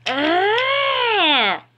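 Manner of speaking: high, drawn-out battle yell; attacking roar. Acted. Stronger engine lunging at a rival, high roar. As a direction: yell as you lunge.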